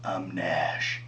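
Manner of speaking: low, flat, overly gruff line delivery; bad acting. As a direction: deliver your name like a wooden tough guy. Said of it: "he delivers it like this".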